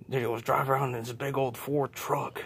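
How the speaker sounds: usual speaking voice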